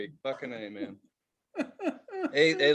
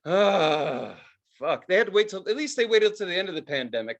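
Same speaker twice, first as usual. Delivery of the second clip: normal talking voice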